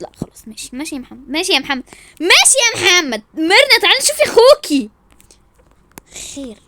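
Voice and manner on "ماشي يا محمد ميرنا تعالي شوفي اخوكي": loud, raised-pitch complaint; indignant shouting. Acted. Wife calls his sister over, shouting indignantly. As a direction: call out in outrage.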